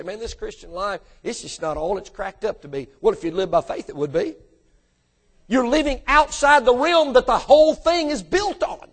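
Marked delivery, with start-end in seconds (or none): 0.0-2.9 mully-grubbing. Retold or imitated. Preacher mimicking discontented Christians, mully-grubbing under their breath. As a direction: imitate a glum, half-hearted complainer. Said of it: "walk around mully-grubbing and say".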